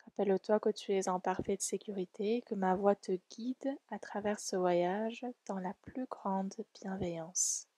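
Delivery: ordinary talk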